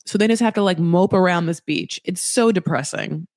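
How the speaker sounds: ordinary talk, nothing marked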